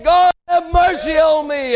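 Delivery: high, drawn-out plea for mercy; lifted-up cry. Acted. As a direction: cry out to God from the pulpit. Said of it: "They lifted up their voice".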